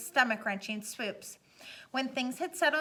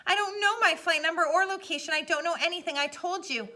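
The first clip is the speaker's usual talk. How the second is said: frantic; 6 semitones higher, 5 dB louder than usual. A narrator's reading. Brian frantic on radio.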